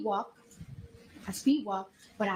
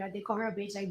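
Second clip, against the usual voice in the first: usual speaking voice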